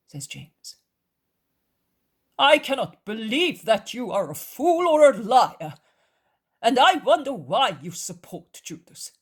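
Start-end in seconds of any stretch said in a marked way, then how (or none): 2.4-9.1 high, loud, swooping emphasis; indignant cousin. A narrator's reading. Cousin challenging his brother over Judas, indignant and emphatic. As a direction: argue forcefully, pitch leaping on key words.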